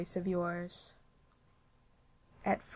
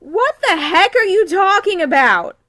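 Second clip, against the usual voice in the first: loud, high exasperated yell; indignant shout; 11 semitones higher, 12 dB louder than usual. Acted. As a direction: blurt it out in loud disbelief. Sudden outburst of disbelief.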